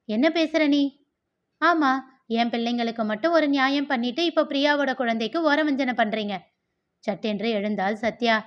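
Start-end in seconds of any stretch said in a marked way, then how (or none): 0.1-0.8 sharp, higher and louder challenge; angry retort. Acted. Sisters-in-law quarrel. 1.6-6.4 heated accusation. Acted. Sisters-in-law quarrel.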